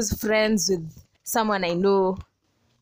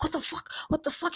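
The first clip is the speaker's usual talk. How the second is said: higher, hushed mock panic; imitating freak-out; 6 semitones higher, 6 dB quieter, faster than usual. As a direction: act out a guy freaking out, pitched up. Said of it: "like, freaking out".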